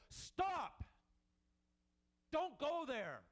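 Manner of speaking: high, loud booming command; loud commanding call from the sky. Pretend. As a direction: boom it out like thunder from above. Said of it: "this booming voice".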